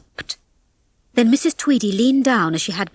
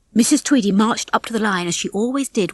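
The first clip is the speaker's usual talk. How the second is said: ordinary delivery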